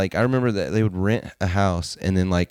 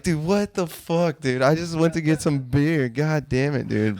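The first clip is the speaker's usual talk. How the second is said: mock outrage; 8 semitones higher, slower than usual. Retold outburst at friends.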